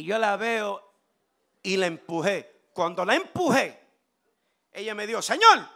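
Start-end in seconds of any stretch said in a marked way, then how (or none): 5.2-5.7 loud, high cry to the Lord; sudden mock-pious exclamation; acted outcry. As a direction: imitate a startled woman calling on God. Acts young woman crying to God.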